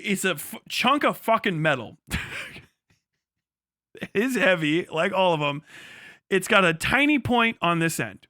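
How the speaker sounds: usual speaking voice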